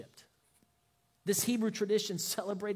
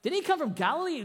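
fast, high, louder mock disbelief; incredulous; 7 semitones higher, 5 dB louder, faster than usual. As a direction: scoff at the idea as if it were absurd. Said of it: "the others are like".

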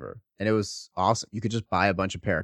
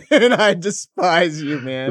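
mock hate; 4 semitones higher, 6 dB louder, slower than usual. Mock hatred of the producer.

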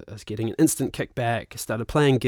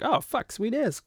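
much higher and soft, imitating a thrilled guy; mock delighted reaction; 11 semitones higher, 4 dB quieter than usual. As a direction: squeak it out like someone impressed.

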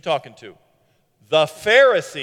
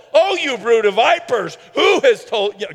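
loud, mocking rebuke to the Pharisees; a sharp, sarcastic jab delivered at a shout; sarcastic Jesus; 3 semitones higher, 6 dB louder than usual. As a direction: throw the insult with a sarcastic bite. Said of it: "he gets a little sarcastic sometimes".

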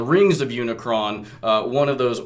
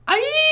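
squeaky high cry; high yelp. Acted. Life spark cry voiced.

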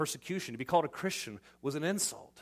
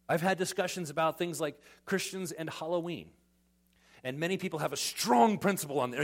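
normal talking voice

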